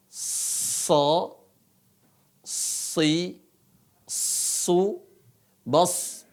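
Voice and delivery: SO, SI, SU, BOS with long, heavy s; thick emphatic s, vowels coloured toward O; huruf so tebal. Shown as a demonstration. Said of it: "Dalam semua keadaan itu dibaca te". (baca "So, si, su, bos" tebal, s ditahan)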